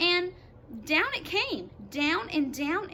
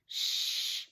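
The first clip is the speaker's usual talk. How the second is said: whispered shush. Acted. James hushing the others to listen, whispered. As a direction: hush everyone with a whisper.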